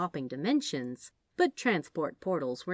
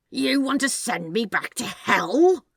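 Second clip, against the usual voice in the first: loud, rising alarm at the word hell; 6 semitones higher, 9 dB louder than usual. Acted. Demon snake balks at being sent to hell, loud alarm. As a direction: bolt up and demand it, outraged.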